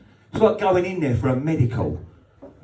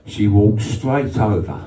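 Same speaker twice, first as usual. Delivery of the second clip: ordinary talk, nothing marked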